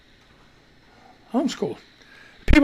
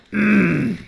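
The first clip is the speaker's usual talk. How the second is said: ordinary delivery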